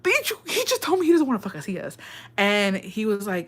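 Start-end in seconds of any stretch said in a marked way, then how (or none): 0.0-2.0 very high squealed disbelief; high-pitched mock-outraged protest; incredulous retort. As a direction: squeak it out, exasperated. Incredulous retort in the retold scene.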